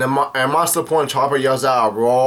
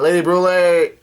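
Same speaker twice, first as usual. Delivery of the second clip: higher-pitched imitated cry of alarm; mock yell; 5 semitones higher, 4 dB louder than usual. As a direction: call out as the worried crew, moderately loud. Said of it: "her crewmates yell out".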